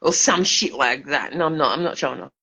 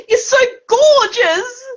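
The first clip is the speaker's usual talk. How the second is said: high, loud sobbing cry; crying shout; 6 dB louder, slower than usual. Real, not acted. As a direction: wail it out through tears. Drunk emotional plea to mum.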